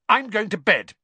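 high, loud, cross snap; snapped. A narrator's reading. Hermione storming off to bed, snapping. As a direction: snap it to end the argument. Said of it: "Hermione snapped".

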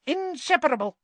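squeaky little voice chiming in. A narrator's reading. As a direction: pipe up eagerly in a tiny high voice. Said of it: "chimed in Professor Flitwick".

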